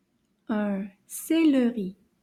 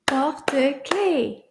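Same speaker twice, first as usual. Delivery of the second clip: Porte-clés slowly split into its syllables; slower than usual. Shown as a demonstration. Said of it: "see how many syllables".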